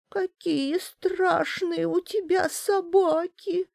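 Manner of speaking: high, slow, trembling toothless crone; toothless mumble. A narrator's reading. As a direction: mumble fearfully like a toothless old hag. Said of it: "дрожащим голосом прошамкала старушонка". (шамкающий дрожащий старушечий голос)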